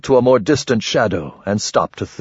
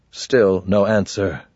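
plain reading voice

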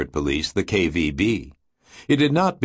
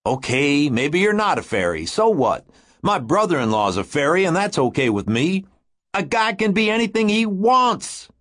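calm start, building higher to a shout; rising to a shout; 9 semitones higher than usual. Acted. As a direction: climb until you're shouting. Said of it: "Pete's voice rose to a shout".

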